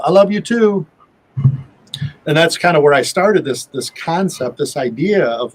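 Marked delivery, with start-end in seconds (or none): 0.0-0.9 higher, mild mock yell in reply; retold yell back. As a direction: imitate calling back to someone. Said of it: "you yelled out".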